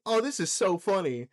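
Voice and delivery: ordinary talk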